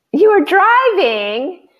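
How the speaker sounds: high, slow delighted cry; light up at the news; excited exclamation. Real, not acted. Winner found driving on call.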